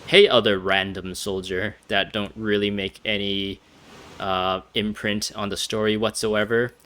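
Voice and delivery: ordinary talk, nothing marked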